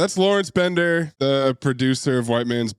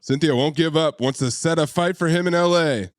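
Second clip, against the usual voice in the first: normal talking voice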